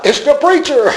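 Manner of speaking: put-on high, excited child warning the house, half laughing; child's alarmed cry. Child inside warns family the preacher has come, high excited cry. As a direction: call out like a kid spotting the pastor at the door. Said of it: "one of the children inside".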